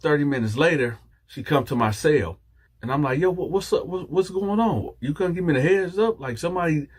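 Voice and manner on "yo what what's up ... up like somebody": high-pitched, puzzled questioning; acted bewildered protest. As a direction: press her for an explanation. Retells confronting her for answers.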